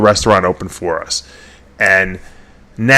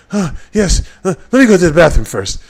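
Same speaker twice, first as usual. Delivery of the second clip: a hint of Nasser's accented voice, a bit higher; mild Nasser impression, casual; lightly voiced Nasser; 6 semitones higher than usual. Nasser tells his friend he'll use the bathroom first, lightly voiced.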